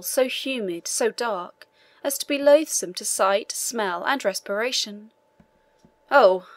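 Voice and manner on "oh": mildly shocked outcry, much louder; exclaimed cry. A narrator's reading. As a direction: let out a brief, loud exclamation. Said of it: "cried the inspector".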